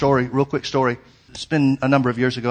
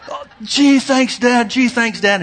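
put-on much higher, louder boy's voice, overjoyed; excited kid; 12 semitones higher, 6 dB louder, slower than usual. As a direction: play the thrilled son thanking dad. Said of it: "and he's going".

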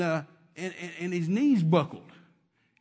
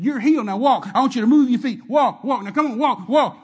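loud, higher yelled commands; yelling; 5 semitones higher, 11 dB louder than usual. Retold or imitated. Preacher yelling at the man to walk. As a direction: yell it right in his face. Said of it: "yelling at him".

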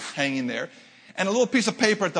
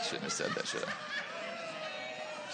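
breathy laughing whisper, very high; a giggling whispered aside after a risky joke; whispered regret; 14 dB quieter, much slower than usual. Real, not acted. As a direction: whisper it to yourself with a guilty giggle. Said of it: "A little sinking feeling".

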